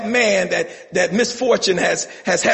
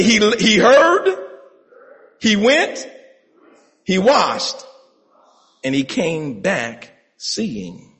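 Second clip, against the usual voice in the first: each phrase punched for the congregation to echo; chanted line for the church to repeat; rhythmic call for repetition; slower than usual. Shown as a demonstration. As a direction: lead them: went, washed, seeing. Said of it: "Say it again".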